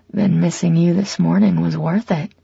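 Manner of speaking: ordinary delivery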